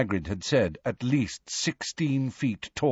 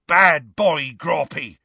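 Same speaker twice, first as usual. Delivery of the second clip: higher, louder scolding yell; 5 semitones higher, 4 dB louder, slower than usual. A narrator's reading. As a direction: scold the giant like a dog. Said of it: "heard Hagrid yelling".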